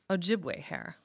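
cold, curt correction; icy correction. A narrator's reading. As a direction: correct him icily. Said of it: "corrected him icily".

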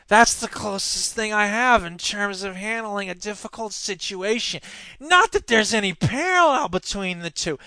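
sniveling, whiny politician impression; Scott Walker. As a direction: whine through it, feebly defensive. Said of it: "I should do it like a whiny, sniveling guy".

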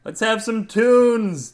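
loud, high, slow party yell. A narrator's reading. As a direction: whoop it out to the car. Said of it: "Jerry yelled".

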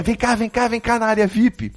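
high, eager call to a friend. Retold or imitated. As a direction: call him over from afar. Calling friend over at party.

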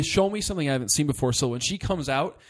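normal talking voice

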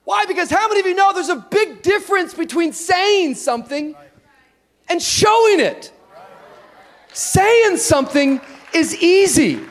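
loud, high, emphatic preaching. Acted. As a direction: preach it out with full force. Preaching at full force.

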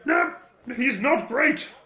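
high, slow, put-on indignant yell; mock outraged shout. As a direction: ham up the outrage, pitched high.

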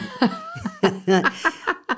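a bright high laugh after her own joke; laughing at her own boast. Real, not acted. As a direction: laugh up high, amused at herself.

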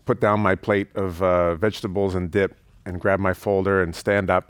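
ordinary talk, nothing marked

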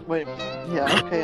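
ordinary talk

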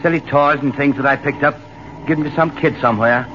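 put-on easygoing Australian accent; Australian lieutenant talking about his souvenirs, in an Australian accent. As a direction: chat fondly, in an Australian accent.

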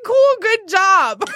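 hammed-up congratulations; mock enthusiastic praise. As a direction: act out over-the-top approval, slowly. Acting out the wished-for praise.

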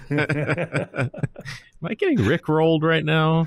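giggling in disbelief; laughing. Real, not acted. Co-hosts sing rickroll at him.

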